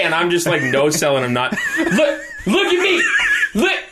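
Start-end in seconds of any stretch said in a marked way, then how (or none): 1.8-3.8 very high, insistent mock demand; imitated drunk. Drunk friend pestering someone ignoring him, high mock demand. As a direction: badger someone like a drunk begging for attention. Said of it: "comes in there all drunk and goes".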